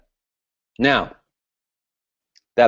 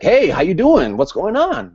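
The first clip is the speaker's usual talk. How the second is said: high, eager mock greeting; a hint of high-energy hype; fired up; 8 semitones higher, 3 dB louder than usual. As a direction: play the pumped-up prospect. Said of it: "they're fired up".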